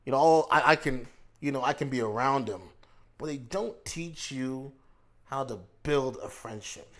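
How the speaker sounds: usual speaking voice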